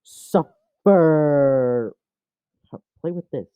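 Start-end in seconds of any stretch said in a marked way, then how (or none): 0.0-1.9 long, stretched mock-villain taunt; drawn-out taunt. Owner torments the held cat, dragging out the word. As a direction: stretch out both syllables theatrically.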